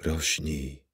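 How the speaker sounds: a soft whispered command to the seed; hushed whisper. A narrator's reading. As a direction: breathe the word over the stone. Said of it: "szepnęła".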